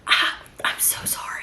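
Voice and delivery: breath-only, embarrassed whisper; whispered apology. Acted. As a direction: whisper a mortified sorry.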